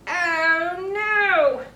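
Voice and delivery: high, loud hammed-up gasp; mock dismay. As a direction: play the shock big for kids. Button pops, dramatic dismay.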